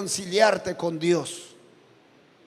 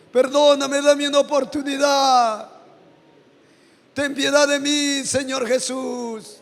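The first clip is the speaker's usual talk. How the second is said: loud, higher, pleading confession to God; fervent modeled prayer; 6 semitones higher, 4 dB louder than usual. Shown as a demonstration. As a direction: pray it aloud for the people at the altar. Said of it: "Dile a Él". (oración ferviente de confesión)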